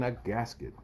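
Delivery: plain reading voice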